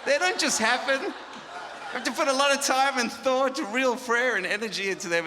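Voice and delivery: loud, high, laughing mock enthusiasm; mock rapture. Real, not acted. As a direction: gush over the failures as if they're treasures. Mock praise of worship fails.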